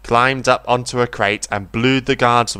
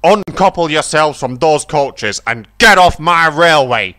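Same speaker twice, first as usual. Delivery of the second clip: louder, higher stern command; angry order; 5 semitones higher, 6 dB louder than usual. A narrator's reading. Fat Controller orders Bruiser off the railway, angry. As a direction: throw him off the railway.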